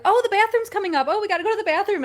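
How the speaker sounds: high, quick excited runner chatter; chatty runners. Retold or imitated. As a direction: imitate eager runners in the back of the pack. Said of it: "everybody's like".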